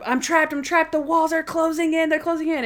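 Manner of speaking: high, panicky mock cry of being trapped. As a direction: play a hammed-up claustrophobic panic. Harold panicking in retold scene.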